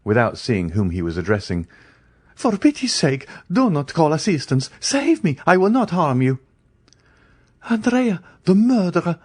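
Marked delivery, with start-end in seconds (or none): 2.3-6.4 high, frantic begging; desperate pleading. A narrator's reading. Andrea caught in the bedroom, begging high and frantic. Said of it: "he cried, pale and bewildered". 7.7-9.2 high frightened outcry; terrified cry. A narrator's reading. Lady waking to find a man, crying out in fear. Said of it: "cried one of the ladies".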